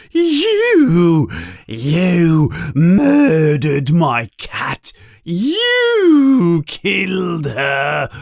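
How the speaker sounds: high, drawn-out screech of accusation. A narrator's reading. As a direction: screech slowly in grief and fury. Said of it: "he screeches".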